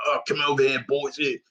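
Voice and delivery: lightly imitated Cockney voice; Cockney accent. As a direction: throw in a quick Cockney impression of the Penguin. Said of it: "that more Cockney accent".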